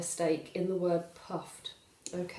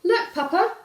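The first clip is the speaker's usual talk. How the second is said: put-on high loud triumphant kid; proud child; 12 semitones higher, 9 dB louder than usual. Valdemar proudly showing Papa his empty plate, high and loud. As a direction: show off like a boy who's cheated. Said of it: "says Valdemar".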